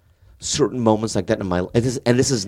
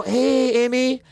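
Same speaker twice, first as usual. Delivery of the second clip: retold high greeting; 12 semitones higher, much slower than usual. His retold awkward greeting back.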